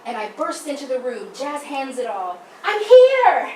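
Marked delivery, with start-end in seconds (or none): 2.6-3.5 much higher, loud, showy announcement; kid's triumphant I'm here; grand entrance. Retold or imitated. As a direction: belt it like a star making an entrance. Bursting in with jazz hands.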